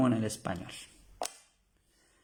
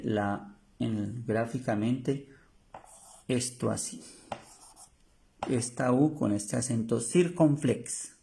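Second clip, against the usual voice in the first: ordinary talk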